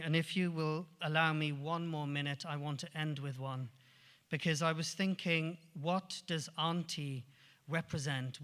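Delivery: usual speaking voice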